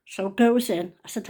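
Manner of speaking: normal talking voice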